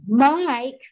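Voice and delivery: high, drawn-out self-satisfied greeting; imitating your own smug phone voice; pleased with myself. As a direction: sing out the name, mock-proud of yourself. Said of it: "with, with this tone".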